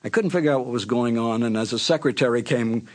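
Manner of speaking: ordinary talk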